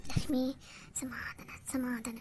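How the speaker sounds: breathless whisper, soothing herself; whispered self-calming. Acted. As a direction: whisper to yourself to keep calm. Calming herself after insult. (ತನಗೆ ತಾನೇ ಪಿಸುಮಾತಿನಲ್ಲಿ ಸಮಾಧಾನ)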